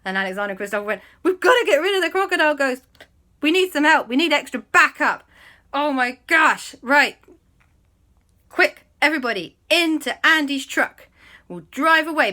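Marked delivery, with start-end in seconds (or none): 1.2-12.3 high, loud rallying cries; rally everyone in mock alarm; urgent panic. Friends hurrying everybody into the truck, urgent panic. Friends flee, calling for backup.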